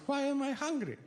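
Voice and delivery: high-pitched, baffled mock mom; imitated stoned mother. Comic imitating his high mother.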